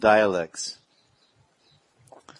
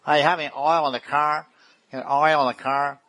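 mock New York accent; 5 semitones higher, 3 dB louder than usual. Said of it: "do you know how they speak over there in New York City".

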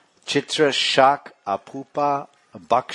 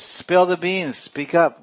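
higher, louder insistent prodding; mock urging; 7 semitones higher, 4 dB louder, slower than usual. Lalita prodding the monkey witness, acted insistent and higher. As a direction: press the witness to talk.

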